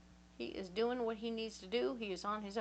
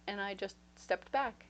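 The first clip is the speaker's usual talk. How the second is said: normal talking voice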